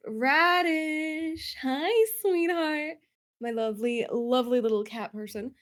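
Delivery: sweet greeting. Real, not acted. Host greets contestant sweetly.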